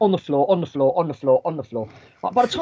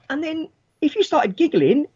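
ordinary talk

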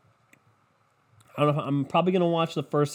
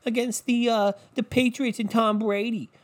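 high, gushing anchor voice; mocking SportsCenter host; 7 semitones higher than usual. Mocking SportsCenter gushing over Texans costumes, high and gushing. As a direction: gush like a giddy TV sports anchor. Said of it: "SportsCenter was like".

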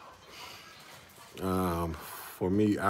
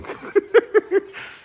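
laugh; 5 dB louder than usual. Real, not acted. Laughing at acronym confusion.

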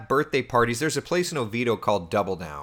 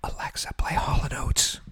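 whispered command; 5 semitones higher than usual. Pretend. Teasing viewers' smart speakers.

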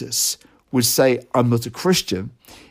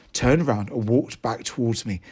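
normal talking voice

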